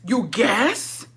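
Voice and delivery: sharp rise on "guess"; incredulous echo. Retold or imitated. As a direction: throw it back as a disbelieving question. Mocks his excuse with disbelief.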